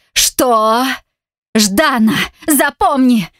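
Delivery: a woman shouting her real name at her abuser; shouted loudly. A narrator's reading. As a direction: yell the name at him. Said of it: "выкрикиваю громко".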